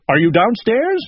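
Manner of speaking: high questioning call. A narrator's reading. Owl calls downstairs to himself, calling out. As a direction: holler a question down the stairs. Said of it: "he cried".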